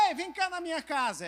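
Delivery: breezy hey-come-on-over call; casual calling-out invitation. Acted. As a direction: call someone over to your house.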